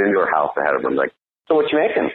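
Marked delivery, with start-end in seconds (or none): 1.5-2.1 put-on high, sing-song little kid voice; goofy kid asking about dinner; childish playful voice. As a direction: ask like a giddy seven-year-old. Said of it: "I'd go playful, almost like a seven-year-old playful".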